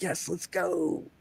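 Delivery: a long, dreamy "Yes, let's go"; drawn-out cheer. Retold or imitated. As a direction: savour each word like a quiet victory. Walking off after passport check.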